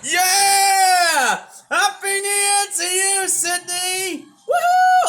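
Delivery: high, loud cheering yell; excited new year shout. Real, not acted. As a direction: scream it out with joy as midnight hits. Midnight fireworks start, cheering.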